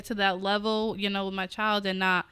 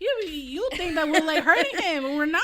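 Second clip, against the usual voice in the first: higher, louder, laughing sarcastic voice; mock sarcastic retort; 9 semitones higher, 5 dB louder than usual. Imagined reply to neighbours who hear screaming, sarcastic. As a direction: talk back at nosy neighbours, amused. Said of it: "I'm just like".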